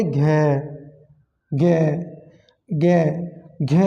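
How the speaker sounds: isolated ग and घ, voiced and humming; voiced consonant demo. Shown as a demonstration. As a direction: sound घ and ग with a buzz in the throat. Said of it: "एक vibration सी महसूस होगी आपके अंदर".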